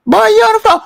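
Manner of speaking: mock parent's loud, high holler; yelling parent. Parent catching a boy on a late call, high hollering. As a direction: yell it very high, like catching a kid out.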